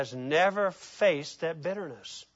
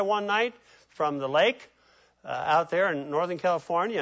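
normal talking voice

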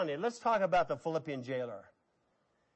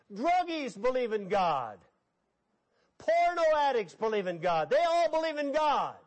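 punchy, repeated sermon refrain, pitch raised at the peak; emphatic preaching; 3 semitones higher than usual. Real, not acted. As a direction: hammer each example home to the congregation. Preacher lists who believes.

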